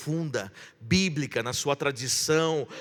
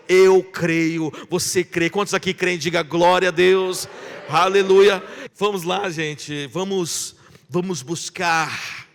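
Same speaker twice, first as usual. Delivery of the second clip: louder, rising call-and-response fervor; preaching; 4 semitones higher, 6 dB louder than usual. Acted. Pastor rallying the congregation to believe, full preaching voice. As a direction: rouse the church to shout praise.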